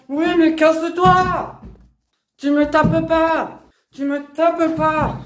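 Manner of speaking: real scream in a scuffle; furious screaming. Real, not acted. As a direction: scream to stop her hitting. Physical fight with mother.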